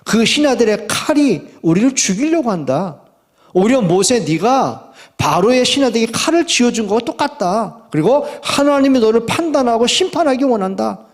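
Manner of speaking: resentful complaint. Acted. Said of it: "이렇게 원망을 합니다". (원망하며 따지는 말투)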